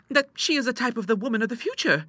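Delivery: put-on very high girlish voice; prim, high-pitched young lady; demure daughter. A narrator's reading. Clara flattering her papa about their neighbour, demurely. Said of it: "said Clara demurely".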